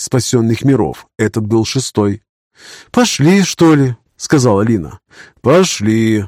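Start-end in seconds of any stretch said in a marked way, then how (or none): 2.9-4.0 a higher, lightly voiced Lina; lightly voiced young woman. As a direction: lift the pitch for the woman.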